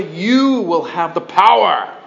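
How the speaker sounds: slow, put-on guru voice; drawn-out mocking preacher tone. Preacher mocking a TV faith guru, slow and put-on.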